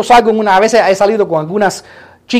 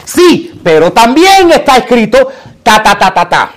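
Jesús; 2 semitones higher, 4 dB louder than usual. Acted. Jesus answering the devil with scripture, forceful comeback. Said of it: "Jesús viene para atrás y dice".

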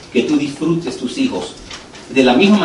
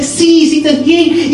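high, slow, drawn-out praise; celebrating parent; 12 semitones higher, 3 dB louder, much slower than usual. Retold or imitated. Father praising his potty-training son, high celebratory voice. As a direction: gush slowly over a child's success. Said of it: "hay que celebrarlo".

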